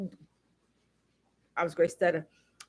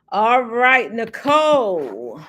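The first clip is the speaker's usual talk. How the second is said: ordinary talk, nothing marked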